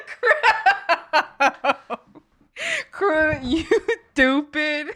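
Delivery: high, laughing, half-crying mock scold. Real, not acted. Teasing Crow through laughter over pulled pork.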